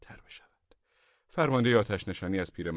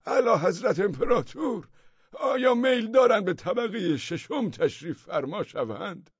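high, loud formal address; old man calling up to the madman; courtly shout; 13 semitones higher, 6 dB louder than usual. A narrator's reading. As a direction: shout up with mock court manners. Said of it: "فریاد زد".

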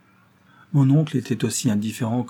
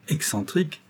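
higher-pitched, scornful question; disdainful echo; 5 semitones higher, 3 dB quieter than usual. A narrator's reading. Young woman dismissing the suggestion about her cousin, disdainfully. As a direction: repeat the word with mild disdain. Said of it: "relevant ses lèvres merveilles d'un air de dédain".

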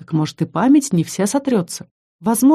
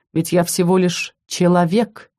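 plain reading voice

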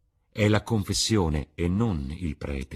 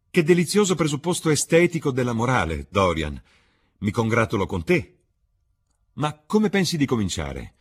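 high, loud amused irony; ironic Lord Henry; 7 semitones higher, 7 dB louder than usual. Acted. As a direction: congratulate him with a wink in the voice. Lord Henry mocks the morality. (Lord Henry ironico, acuto e più forte)

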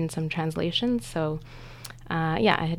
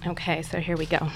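ordinary talk

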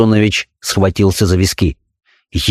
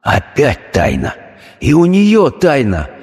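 anguished thought; 6 semitones higher, much slower than usual. A narrator's reading. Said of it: "с тоскою подумал доктор".

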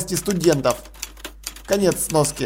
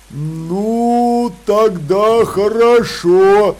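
slow troll voice; 4 dB louder, much slower than usual. Acted. Troll Detritus gives in.

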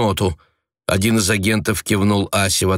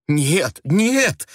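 high, desperate denial; desperate cry; 9 semitones higher than usual. A narrator's reading. Wife to husband, desperate cry. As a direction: plead in despair, rising high. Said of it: "воскликнула она с отчаянием".

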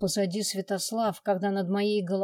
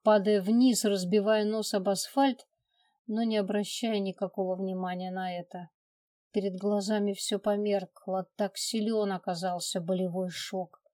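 ordinary delivery

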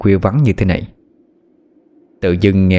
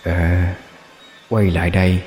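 swollen tonsils voice; slower than usual. A narrator's reading. Ghost voice taunting, hoarse.

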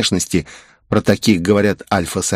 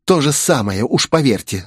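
put-on higher-pitched girl's protest; raised-pitch defiant objection; 6 semitones higher than usual. A narrator's reading. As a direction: insist on it, indignant. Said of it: "запротестовала девушка".

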